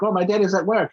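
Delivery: a hint of a little boy answering the phone; young son. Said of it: "And he's like".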